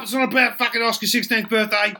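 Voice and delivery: high brash bloke, reassuring crudely; Duck's voice. Acted. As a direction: keep it high and matey. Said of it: "And Duck's like".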